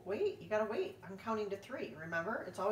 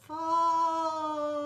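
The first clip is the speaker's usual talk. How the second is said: slow, high, loud falling cry; drawn-out fall; 11 semitones higher, 9 dB louder than usual. Acted. Narrator falls through the door, long high loud wail. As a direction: stretch it out as you drop. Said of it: "narrator falling through space".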